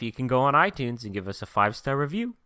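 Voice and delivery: ordinary delivery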